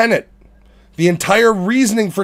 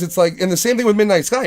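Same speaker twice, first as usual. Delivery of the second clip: ordinary delivery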